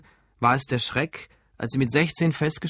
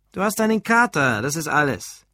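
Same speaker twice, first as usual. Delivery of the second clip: amused; 3 semitones higher than usual. A narrator's reading. Alfred teases his hungover colleague, amused. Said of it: "lachte Alfred".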